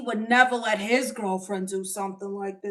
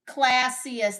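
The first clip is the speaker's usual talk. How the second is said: normal talking voice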